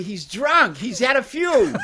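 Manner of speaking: high, loud mock heckle with a laugh; imitating yelling kids. Novelist imitates kids heckling the rider, mock yelling, amused. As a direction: jeer it like kids in a crowd. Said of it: "with the kids yelling".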